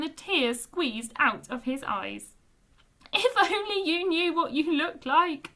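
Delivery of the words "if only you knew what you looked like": wheezy laughter. A narrator's reading. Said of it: "Bungo said wheezily".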